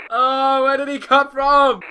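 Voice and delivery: high, loud, panicked question; alarmed shout. Real, not acted. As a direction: shout it in real shock. Repeats it, still shocked.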